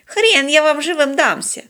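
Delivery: put-on high, scornful old hermit; contemptuous. A narrator's reading. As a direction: defy them with contempt. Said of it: "презрительно отозвался затворник". (презрительно, с вызовом)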